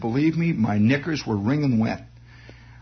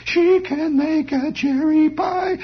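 very high, slow, warbling mock soprano; insane record singer; 13 semitones higher, 3 dB louder, much slower than usual. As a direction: ham up a tall thin lady's shrill record voice. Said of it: "And then on came this voice".

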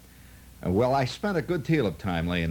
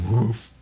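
swing grunt; 5 dB louder than usual. Retold or imitated.

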